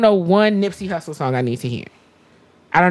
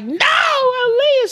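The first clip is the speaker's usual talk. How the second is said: high, slow, drawn-out wail; wailing correction; much slower than usual. Retold or imitated. Teen correcting grandma, high slow wail. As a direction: stretch it out in tears. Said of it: "And I was like".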